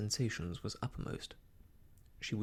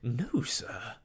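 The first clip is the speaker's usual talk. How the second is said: louder, emphatic refusal; indignant cry; 5 dB louder than usual. A narrator's reading. Emma refusing Mister Elton, indignant cry. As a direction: cut him off firmly. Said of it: "cried Emma".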